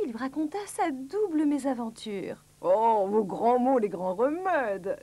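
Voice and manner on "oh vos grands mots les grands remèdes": loud, very slow pronouncement; pompous storybook doctor, drawn out; declaiming doctor. A narrator's reading. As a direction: declare it ponderously. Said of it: "déclara le docteur Pilule".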